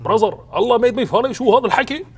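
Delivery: very loud, high, shouting outrage; outraged brother. Retold or imitated. A brother shouts at the comedian over the show's title, loud and high. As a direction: shout at the blasphemy.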